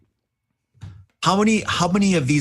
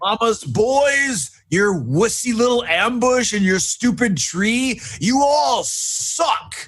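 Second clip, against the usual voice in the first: high, drawn-out battlefield yell; screamed taunt; 12 semitones higher, 3 dB louder, slower than usual. Acted. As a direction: hurl insults at the whole ambush, stretching the words. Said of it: "I'm just gonna scream out".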